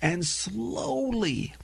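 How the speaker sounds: slow, deliberate enunciation; clearly and slowly. Shown as a demonstration. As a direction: CLEARLY set apart, SLOWLY stretched out. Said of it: "if they spoke clearly and slowly".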